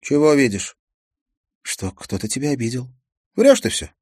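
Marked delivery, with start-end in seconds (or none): 3.4-3.9 angry retort. Acted. Teen girl snaps at detective.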